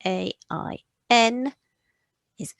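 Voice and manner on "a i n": ordinary talk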